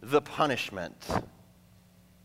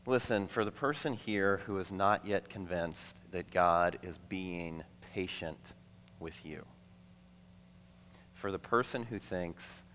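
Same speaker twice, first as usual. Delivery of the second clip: tender appeal; 4 semitones lower, 5 dB quieter than usual. Real, not acted. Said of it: "tender appeal to the unconvinced".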